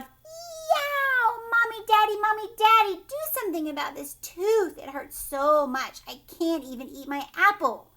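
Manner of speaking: high, very loud cry for both parents; yelling in pain. Acted. Hurt boy calling Mommy and Daddy, yelling. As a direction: wail it out, then keep complaining loudly. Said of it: "and yelled".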